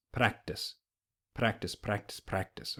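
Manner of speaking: practice with a light tapped R; practice, tongue tapping a quick R; flapped R. Shown as a demonstration. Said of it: "becomes practice".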